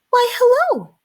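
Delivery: a little mouse chirps a greeting; bright high mouse greeting. A narrator's reading.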